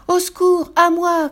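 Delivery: loud, high-pitched distress call; crying for help. A narrator's reading. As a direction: shout for rescue in a panic. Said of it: "cria Lou". (crier au secours, voix aiguë)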